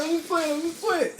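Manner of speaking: a hammed-up kid backing away, high and soft; Little Chris from Friday. Boy one dodging her, in a high soft kid voice. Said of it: "Like little Chris from Friday".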